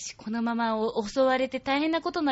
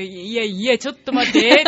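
loud startled stop; sudden double take; 12 dB louder than usual. Retold or imitated. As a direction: catch yourself, loudly. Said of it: "って、戻った".

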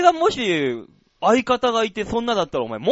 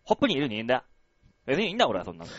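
low, sulky tough-guy mutter; lightly voiced, self-pitying gruff dad; Big Daddy impression; 9 semitones lower, 7 dB quieter, much faster than usual. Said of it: "俺のビッグダディのモノマネ".

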